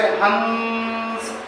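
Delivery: हंस with a long nasal hum before the S; हंस, its N held very long in the nose; nasal anusvara. Shown as a demonstration. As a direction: draw out हंस through the nose. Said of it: "अनुस्वर का उच्चारण नाक से किया जाता है".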